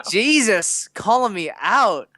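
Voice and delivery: playful, exasperated outburst; mock indignation. Real, not acted. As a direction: cry out as if exposed. Mock protest at teasing.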